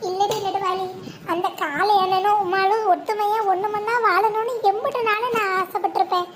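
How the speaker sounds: ordinary delivery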